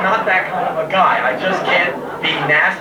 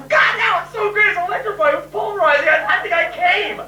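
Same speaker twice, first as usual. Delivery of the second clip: much higher, breathless gushing; gushing girl; 13 semitones higher than usual. Retold or imitated. Girl raving about band practice, high mock gush. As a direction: rave like she loved every second. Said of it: "she goes".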